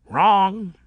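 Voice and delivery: bright, loud, happy contradiction; cheerful. A narrator's reading. Whiskery Face corrects Shade, cheerful and loud. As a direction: chirp it out gleefully. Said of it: "said Whiskery Face cheerfully".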